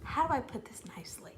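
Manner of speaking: quick, hushed near-whisper; barely voiced, mock-tactful lead-in; restrained aside. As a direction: say it under your breath, holding back the outburst. Holding back before an exasperated outburst.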